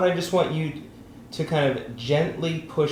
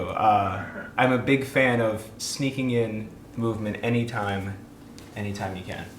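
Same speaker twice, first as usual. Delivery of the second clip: ordinary talk, nothing marked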